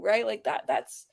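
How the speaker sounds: normal talking voice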